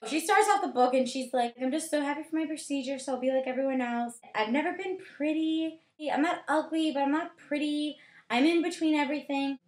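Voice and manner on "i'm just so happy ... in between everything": higher-pitched mock insecure girl voice; hammed-up self-pity, pitched up; self-pitying heroine. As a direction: play a woe-is-me teen narrator.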